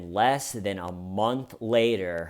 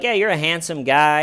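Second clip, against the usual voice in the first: loud teasing; 6 semitones higher, 8 dB louder than usual. Retold or imitated. Comedian teasing Gareth.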